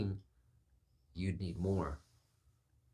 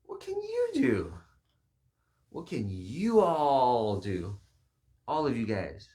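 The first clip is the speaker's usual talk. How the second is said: very high and loud, slow 'what can you do'; hammed-up presidential call to the people; exaggerated orator; 8 semitones higher, 10 dB louder, slower than usual. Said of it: "And he said".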